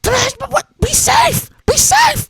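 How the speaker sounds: high mock Gina warning; imitating Gina. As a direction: squeak it like his worried partner. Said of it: "She says".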